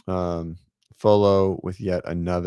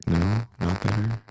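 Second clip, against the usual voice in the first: usual speaking voice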